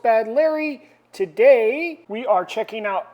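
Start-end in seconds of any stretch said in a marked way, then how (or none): 0.0-0.9 host name announce. Acted. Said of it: "I'm your host".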